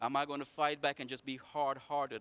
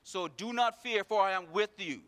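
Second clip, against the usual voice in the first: scripture reading; 8 semitones higher, 8 dB louder than usual. Acted. Reads Isaiah aloud.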